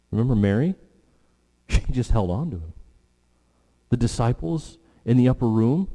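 normal talking voice

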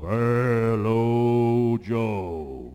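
loud mock voice of God; a mock heavenly boom; booming voice from above. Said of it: "this booming voice from above".

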